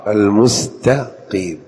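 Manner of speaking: المستقيم slow, with a clear q stop; deliberate qaf in المستقيم; true qaf. Shown as a demonstration. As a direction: separate syllables of المستقيم, hitting ق. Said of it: "فلينطقها قاف".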